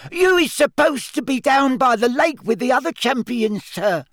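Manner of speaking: high squeaky elf voice; squeaky house-elf. A narrator's reading. Dobby voice.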